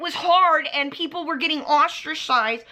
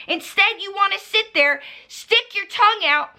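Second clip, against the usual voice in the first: ordinary delivery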